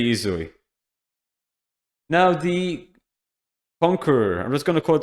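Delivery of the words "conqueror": normal talking voice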